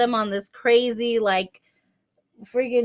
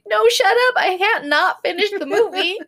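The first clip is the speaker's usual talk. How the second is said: mock irritation; 10 semitones higher than usual. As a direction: snap back in comic outrage. Acted snapping at her husband.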